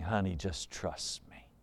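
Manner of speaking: very low, hushed plea to his wife; soft reassurance. Acted. Husband soothing doubting wife.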